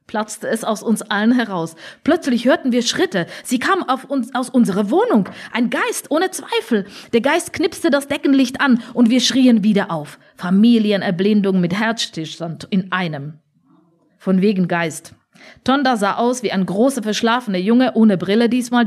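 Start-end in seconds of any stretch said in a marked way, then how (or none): none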